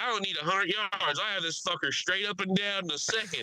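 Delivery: high-pitched boastful passenger imitation; mock announcing. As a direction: brag to five rows as the loudmouth. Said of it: "he's announcing this shit".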